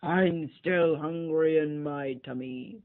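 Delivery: slow loud bear grumble; slow loud hungry bear whine. Acted. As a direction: complain to the penguins like a greedy bear. Bear keeps bellowing.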